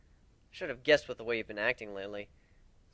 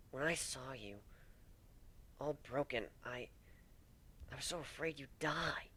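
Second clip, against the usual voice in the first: whisper; 9 dB quieter than usual. Acted. Tearful confession to injured partner.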